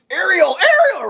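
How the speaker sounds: high, loud repeated name call; imitated owner's high-pitched holler; calling the dog. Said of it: "starts calling his dog's name".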